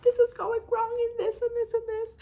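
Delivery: high, whiny mock-sob of complaint; imitating her crying self. Mimicking her old crying videos, high and whiny. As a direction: play up a teary younger you. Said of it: "it's me crying, me sobbing".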